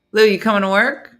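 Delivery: loud questioning call. Real, not acted. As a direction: call over to a slacker in the corner. Calling to Lulu in the corner.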